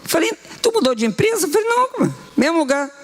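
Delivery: high, amazed back-and-forth; astonished exchange. Retold or imitated. Pastor and preacher retelling the money surprise, high and astonished. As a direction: act out the pastor's disbelief.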